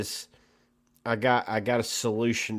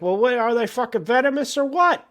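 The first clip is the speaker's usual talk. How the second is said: much higher and louder, fed-up demand; mock outrage at useless snake advice; exasperated outburst; 11 semitones higher, 5 dB louder than usual. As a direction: throw up your hands and demand an answer. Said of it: "And you're like".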